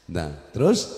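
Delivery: ordinary delivery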